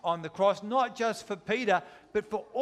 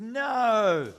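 loud, higher, drawn-out "No"; emphatic denial; 4 semitones higher, 5 dB louder than usual. Acted. Peter protests to Jesus that he won't deny him, emphatic denial. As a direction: protest it loudly, voice lifted.

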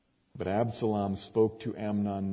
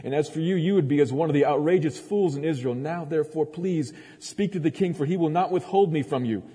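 higher, louder urgent plea; pleading sister; 7 semitones higher, 5 dB louder than usual. A narrator's reading. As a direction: read her plea with rising urgency. Reads Tamar's desperate plea in Scripture.

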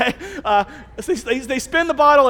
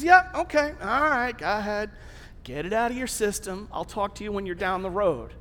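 easy go-ahead, sinking softer and lower; unbothered indulgence; 4 semitones lower, 5 dB quieter than usual. Acted. God letting the runaway go his own way, calmly dismissive. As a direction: let them go, quietly unconcerned.

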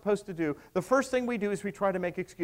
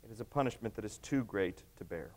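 quiet repetition; 9 semitones lower, 10 dB quieter than usual. Acted. Preacher repeats Cain's words quietly.

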